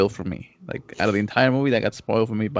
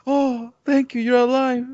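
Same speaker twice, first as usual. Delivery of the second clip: much higher, a mock-romantic gasp of thanks; mock swooning heroine; 14 semitones higher than usual. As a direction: parody a grateful movie heroine. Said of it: "It's like".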